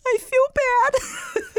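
very high, very slow fake-pitiful whine; mock sad whimper. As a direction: stretch it out high like a sulking child. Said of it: "curled up, like".

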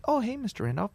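soft, high receptionist hello; assistant greeting. Retold or imitated. Assistant greets returning caller, soft and high. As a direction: imitate a light, polite phone greeting.

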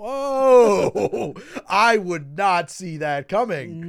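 loud, high, drawn-out astonishment; big stretched whoa, then slow loud disbelief; shocked reaction. Real, not acted. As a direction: blurt it out, stunned by a dark plot twist. Shocked by the dark plot twist.

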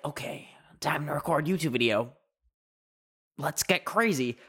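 lightly put-on gearing up for a video; mock YouTuber hype. Vlogger mimicking self before recording, mock hype. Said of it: "it's not that I'm like".

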